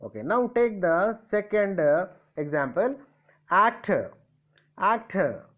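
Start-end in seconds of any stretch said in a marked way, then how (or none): 3.5-5.4 AC-tor, strong opening and falling end; each actor front-weighted, said separately; stress on first syllable. Shown as a demonstration. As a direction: punch the "ac" of actor. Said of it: "Stress is at the first letter".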